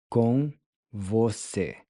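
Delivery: "Com você" slowed, each syllable spelled out; slow clear model. Shown as a demonstration. Teacher slowly modeling "Com você" for students. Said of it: "How would you say with you?".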